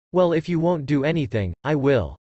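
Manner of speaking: usual speaking voice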